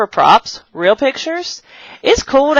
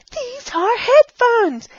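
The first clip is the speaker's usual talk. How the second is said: high playful echo; slower than usual. Shown as a demonstration. Teacher repeating the headphones line, high playful voice.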